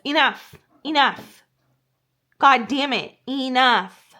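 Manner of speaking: loud fed-up scolding of the dogs; frustrated shout, enough; exasperated yell. Retold or imitated. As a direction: snap at them to stop. Yelling at barking dogs.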